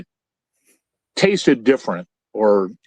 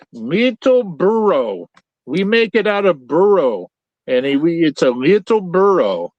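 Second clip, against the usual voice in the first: slow, higher mock Mexican accent; bad Mexican accent; 6 semitones higher than usual. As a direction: spell out the joke in a hammed-up Mexican accent. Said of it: "I'm doing a bad Mexican accent".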